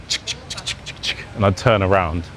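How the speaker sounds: camera shutter clicks. Retold or imitated. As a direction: mimic the shutter under your breath. Imitating phone camera sound.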